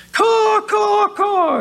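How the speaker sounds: high shouted mock caws; crow caws. Preacher voicing worldly crows with shouted high caws.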